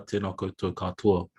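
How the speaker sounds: usual speaking voice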